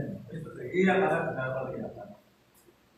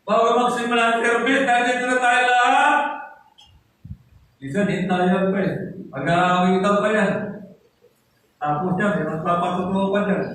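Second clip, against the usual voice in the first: loud, raised pulpit voice; preaching at full force; 5 semitones higher, 5 dB louder than usual. Acted. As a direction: drive it home to the room. Preaching to the congregation.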